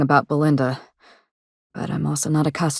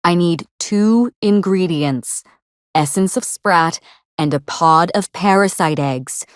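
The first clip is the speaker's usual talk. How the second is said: slow, deliberate; slower than usual. Acted. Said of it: "just listen carefully".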